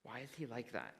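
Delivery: softly imitated child's question; whispering child. Child behind me in line asking his mother, in a hushed voice. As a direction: drop soft, like a curious child asking discreetly. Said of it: "I hear the child behind me whisper to his mother".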